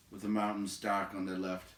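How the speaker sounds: usual speaking voice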